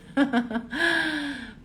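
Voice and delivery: short amused laugh; self-conscious laugh. Real, not acted. Speaker laughing at herself before the audience. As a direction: laugh at having talked so much. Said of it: "eu só eu falei".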